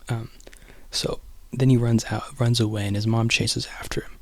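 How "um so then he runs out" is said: ordinary talk, nothing marked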